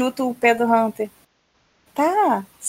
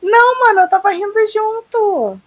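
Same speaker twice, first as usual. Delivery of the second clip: excited; 10 semitones higher, 6 dB louder than usual. Real, not acted. Defends herself, still laughing.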